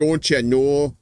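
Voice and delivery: a light Minnesota "Don't you know?" with long flat vowels; Minnesota accent. Retold or imitated. Echoing the friend's guess in a hint of a Minnesota accent. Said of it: "sounds more like a Minnesota type".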